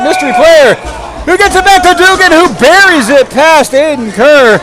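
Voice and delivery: excited goal call. Real, not acted. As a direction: shout the goal as it goes in.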